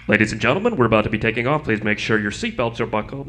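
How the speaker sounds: loud, low cabin crew announcement; loud flight announcement. Retold or imitated. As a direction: blare it like a flight attendant. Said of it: "the flight crew makes the announcements the same way they would on any flight".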